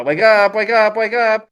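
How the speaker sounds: high, drawn-out calls to wake; insistent waking call. Acted. Zhen calling at sleeping Po, high insistent call.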